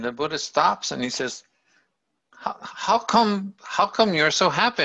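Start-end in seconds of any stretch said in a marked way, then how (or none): 2.3-4.8 high-pitched, halting amazement; incredulous questioning. Retold or imitated. Buddha stops to ask the happy old man, incredulous. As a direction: act out a baffled, wondering questioner.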